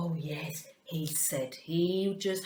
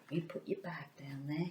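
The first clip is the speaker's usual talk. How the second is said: fast hushed whisper; quiet whisper; 4 semitones lower, 11 dB quieter, faster than usual. Acted. Teacher whispering to the puppet about his bag. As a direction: hurry it under your breath.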